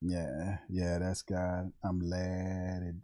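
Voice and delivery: low, soft and very slow, totally nonchalant; cool as the other side of the pillow, low and slow; Lattimore. Acted. Doing Lattimore for the chat, low, slow and nonchalant. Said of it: "Everything is slow and smooth".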